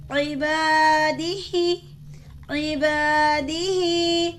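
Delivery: عبادی ہی recited slowly with the final ہی held long; slow drawn-out recitation. Shown as a demonstration. Teacher repeats the word, stretching the ending. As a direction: hold the ہی of عبادی ہی a full beat. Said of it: "کھینچ کے ادا کریں گے".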